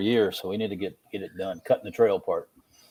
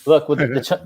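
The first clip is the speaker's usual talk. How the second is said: normal talking voice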